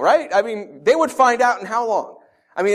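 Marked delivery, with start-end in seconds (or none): none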